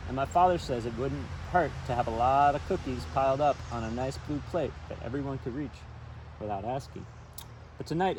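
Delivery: story narration. A narrator's reading. Reading a children's book aloud by the river, storytelling narration. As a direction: read it out like a picture book for kids.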